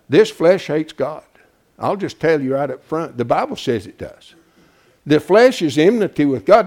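ordinary talk

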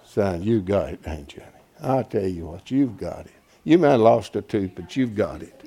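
low, soft, affectionate encouragement; quiet, fatherly praise to a congregant; fond praise. Real, not acted. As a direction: reassure him kindly.

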